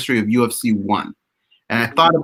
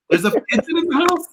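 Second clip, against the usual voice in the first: surprised. Real, not acted. Shocked by pigeon in house.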